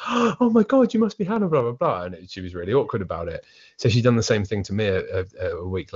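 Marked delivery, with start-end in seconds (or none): 0.1-2.0 high, excited mum gushing down the phone. Retold or imitated. Son imitating his mum greeting his girlfriend, gushing. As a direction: gush in a high voice, settling as it tails off. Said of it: "my mum went".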